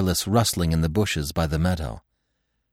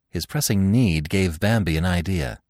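ordinary delivery, nothing marked

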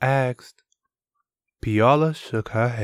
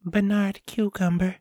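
"Bernard Cucumber" said higher, hushed and trembling; a frightened girl naming the villain; quivering voice; 11 semitones higher than usual. Acted. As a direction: let the name shake out of her. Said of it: "Her voice quivered".

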